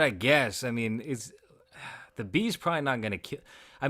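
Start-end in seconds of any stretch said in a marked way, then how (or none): 0.0-0.6 said again louder for someone who missed it; repeated loud so the listener can hear. Real, not acted. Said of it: "I said".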